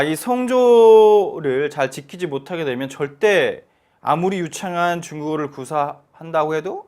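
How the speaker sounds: ordinary delivery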